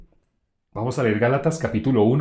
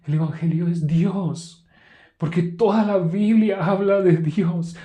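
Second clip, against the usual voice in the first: slow, higher, pious sing-song; mock spiritual voice; 5 semitones higher, slower than usual. Preacher mocking churchgoers' pious answers, mock spiritual voice. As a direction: parody a churchgoer sounding holy. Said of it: "un timbre de voz que suene como espiritual".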